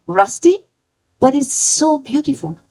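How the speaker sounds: usual speaking voice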